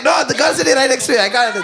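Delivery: loud laughing mock protest. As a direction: shout it like the embarrassed women. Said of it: "They're like".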